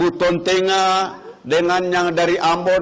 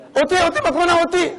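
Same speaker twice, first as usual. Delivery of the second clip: put-on Sulawesi accent; 10 semitones higher, much faster than usual. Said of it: "Ketemu orang Sulawesi".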